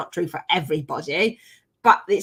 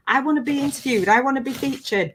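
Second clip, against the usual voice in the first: lightly mimicked eager pleading, pitched up; quick, higher-pitched "me too" eagerness; eager wannabes; 6 semitones higher than usual. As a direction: imitate people keen for the spotlight. Mimicking eager people wanting exposure.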